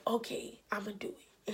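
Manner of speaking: soft, low-key self-talk, nearly whispered at the end; quiet resolve. Retold or imitated. Telling herself to open up to the coworker, quiet resolve. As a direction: murmur it as a private decision.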